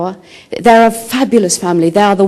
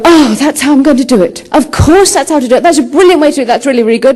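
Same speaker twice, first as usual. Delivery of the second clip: much higher and louder, thrilled at the idea; eureka excitement; 8 semitones higher, 5 dB louder than usual. Retold or imitated. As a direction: burst out with delight at the solution. Sudden idea after long walk.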